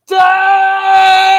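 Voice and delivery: loud high shout of a name. Real, not acted. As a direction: yell the name out joyfully. Shout-out while spinning on chair.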